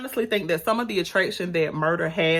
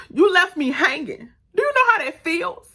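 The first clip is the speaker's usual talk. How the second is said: hurt confrontation; 10 semitones higher, 4 dB louder than usual. Retold or imitated. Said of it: "he was like".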